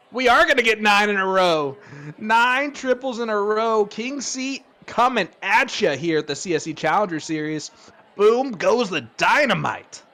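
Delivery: loud, high, stretched-out big-moment call; excited play-by-play. Real, not acted. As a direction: announce the record moment at full voice. Live call of nine triples.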